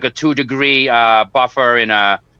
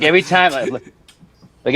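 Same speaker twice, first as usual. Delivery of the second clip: usual speaking voice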